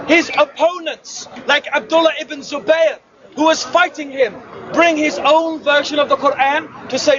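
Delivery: normal talking voice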